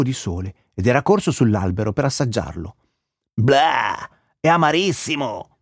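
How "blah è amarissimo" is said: mild disgust with a drawn-out blah. A narrator's reading. Squirrel tastes the bitter eggshell, mildly disgusted.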